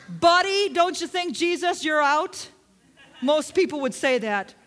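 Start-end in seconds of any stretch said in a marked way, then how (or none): 0.1-2.5 higher and louder, rousing the crowd; emphatic challenge. Real, not acted. Preacher calling to the congregation, raised and emphatic. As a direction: throw it out to the room like a dare.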